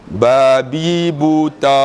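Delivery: each syllable stretched very slowly; drawn-out recitation. Acted. Pupil reciting the syllables he remembers, slow singsong drawl. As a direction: chant it syllable by syllable, sustained. Stringing memorised syllables into accidental insult.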